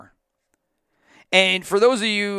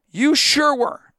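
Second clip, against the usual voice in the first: sarcastic; 9 semitones higher, 5 dB louder, slower than usual. Real, not acted. Sarcastic retort to quote.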